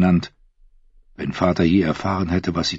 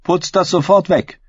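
commanding; 12 semitones higher than usual. A narrator's reading. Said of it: "befahl die Königin ihr".

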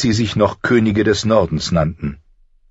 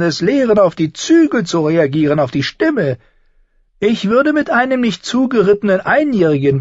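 higher, sly and needling; a higher, sardonic little man's voice; mocking dwarf Tyrion; 14 semitones higher, 3 dB louder than usual. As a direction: talk down to a would-be lord with cool mockery.

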